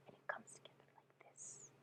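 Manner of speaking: barely audible breath of a whisper; hushed whisper. Acted. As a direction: let it vanish into a secretive whisper. Hushed end of mystical lore.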